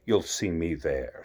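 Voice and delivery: a lazy grinning cat, unhurried; Cheshire Cat. Acted. Cheshire Cat voiced by reader.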